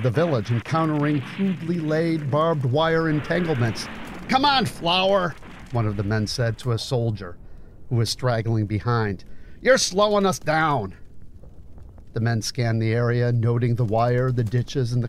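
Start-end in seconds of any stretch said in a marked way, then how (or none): 4.3-5.3 higher-pitched impatient call; impatient chiding. Acted. Soldier chiding a straggler. 9.6-10.9 raised-pitch complaint to a straggler; impatient chiding. Acted.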